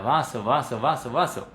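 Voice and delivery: "vaso" with the jaw kept almost shut; nearly closed jaw. Shown as a demonstration. Teacher demonstrating neutral sounds, nearly closed jaw on "vaso". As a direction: repeat "vaso" through a closed-up mouth. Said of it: "mantengo la mandíbula más bien cerradita".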